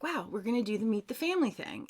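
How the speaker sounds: quick breathy whisper of excitement; hurried whispered thrill; excited whisper. Retold or imitated. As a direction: whisper it fast, giddy at the news. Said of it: "was like".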